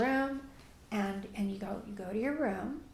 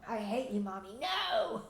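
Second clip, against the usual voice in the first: put-on defiant child; 3 dB quieter than usual. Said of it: "in your face".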